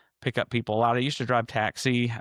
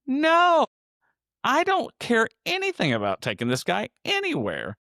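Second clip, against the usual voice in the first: a much higher, louder, exasperated "No" that stays high; emphatic refusal; 11 semitones higher, 3 dB louder than usual. Retold or imitated. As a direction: refuse outright, pitched up and loud. Retelling his refusal to the cop.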